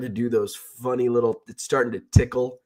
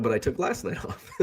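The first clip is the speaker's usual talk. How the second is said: laughing; 7 dB quieter than usual. Retold or imitated. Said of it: "And I'm like".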